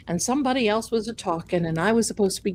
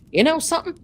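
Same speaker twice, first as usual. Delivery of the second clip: higher-pitched imitated Southern pastor; mock Southern accent; 7 semitones higher, much faster than usual. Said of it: "he's got this Southern accent".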